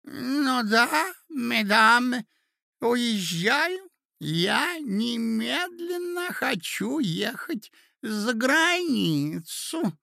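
put-on high, slow, drawn-out old man's voice; doddering old prince. A narrator's reading. Old prince telling the ladies his travel plans, doddering. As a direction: stretch each word out in a high pitch.

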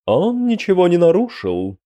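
high-pitched, impassive character voice; dispassionate. A narrator's reading. Arbiter answering those who would kill, high and dispassionate. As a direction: state it in a high, unmoved voice. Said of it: "бесстрастно произнёс Бекуян".

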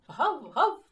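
two loud high woofs; dog barking. Acted. Dog backing up the boy, loud barking. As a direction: bark along eagerly. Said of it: "підтримав його Бровко".

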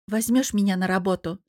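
usual speaking voice

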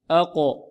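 أقو as a qaf with a bouncing release; short echoing pop on the q in أقو; قلقلة القاف. Shown as a demonstration. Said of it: "نطبق الآن". (أقو بقلقلة القاف)

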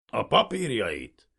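loud, drawn-out gruff order; slow, hostile command; snarled demand. A narrator's reading. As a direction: growl the demand curtly. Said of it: "mordult rá németül".

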